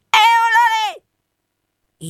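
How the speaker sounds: a strange bird calling long and loud; drawn-out bird cry. Acted. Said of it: "gridò dall'alto". (richiamo d'uccello lunghissimo, molto acuto)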